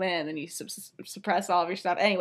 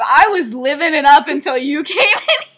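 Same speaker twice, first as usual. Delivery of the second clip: laughing tease; 5 semitones higher, 11 dB louder than usual. Real, not acted. Sirius teasing, laughter.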